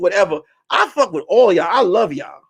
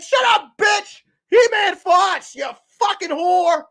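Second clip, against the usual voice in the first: hammed-up fanboy rage; mock furious yell; 12 semitones higher, slower than usual. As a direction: yell it like an outraged fan in a chat fight. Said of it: "The dude was like".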